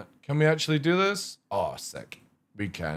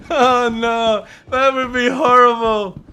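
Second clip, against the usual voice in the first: laughing mock horror; 9 semitones higher, 7 dB louder, slower than usual.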